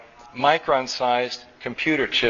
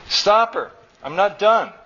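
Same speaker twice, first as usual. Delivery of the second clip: high-pitched, moderately raised cry; child's protesting scream; 7 semitones higher, 4 dB louder than usual. A narrator's reading. Boy begging his father to stop mother, mild scream. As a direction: call urgently for help as a boy. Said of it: "screamed Chu".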